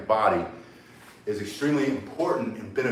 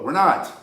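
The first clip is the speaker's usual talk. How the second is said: firm "not", louder and higher; emphatic denial; 5 semitones higher, 6 dB louder than usual. Real, not acted. Rejecting the same-boat cliché to viewers, emphatic "not". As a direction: knock the saying down with "not". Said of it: "rebutting the same-boat saying".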